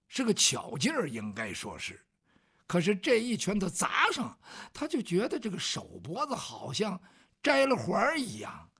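usual speaking voice

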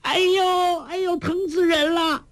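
very high squealing wail; crying out in pain. A narrator's reading. Fat lady screams over her nose, high squeal. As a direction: squeal it out as if badly hurt. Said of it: "大叫".

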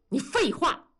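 high, irritated comeback; irritated retort. A narrator's reading. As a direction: cut the teasing son off. Father cuts off teasing son. (不耐烦地顶回去)

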